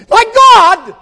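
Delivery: high, sustained shout; agonized cry. Acted. Jesus abandoned by the Spirit, anguished shout. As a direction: cry out as the dove leaves. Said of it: "Jesus cries out".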